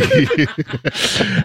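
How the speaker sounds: laughing high with a rise; giggling along; high giggle. Real, not acted. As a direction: giggle rising at the end. Laughing at the Boston joke.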